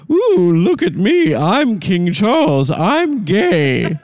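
high, slow campy prince voice; a high camp gloat; mock Andrew voice. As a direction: ham up the smug brother. Joke read with Andrew impression.

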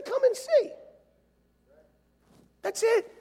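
very high, light call; playful invitation. Shown as a demonstration. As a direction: pipe it up high, as if effortless. Showing how simple the invitation is.

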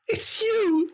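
breathless delighted squeal; excited gasp. Retold or imitated. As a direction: gasp it out like a starstruck child.

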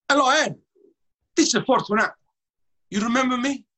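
high, loud Italian-American phone greeting; Fortunato's Italian accent. Retold or imitated. Owner phoning the singer at work, loud Italian accent. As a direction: call him up like the old Italian owner. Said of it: "named Fortunato that talk like that".

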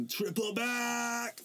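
slow, high hype shout; excited call. Retold or imitated. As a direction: call out the trick. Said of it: "I'm like".

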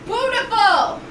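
high loud exclamation; excited. Real, not acted. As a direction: exclaim with delight. Admiring flowers loudly.

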